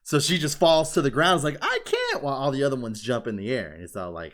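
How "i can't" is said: very high mock cry of helplessness; mock girlish wail. Fan voicing the girl who falls to the ground, mock wail. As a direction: squeal it like a flustered anime girl.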